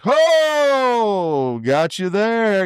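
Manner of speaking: high, long-held "Ho" sliding down; Speedy impression. Partner calling out after every miss, high drawn-out impersonation. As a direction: stretch it out high and slow like the partner's call. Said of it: "That's how he talks".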